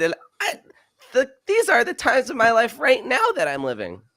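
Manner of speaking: put-on high, bouncy little beach kid; kid surfer voice. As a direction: read it like an excited kid at the beach. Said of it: "I'm gonna do, like, a kid surf-, surfer".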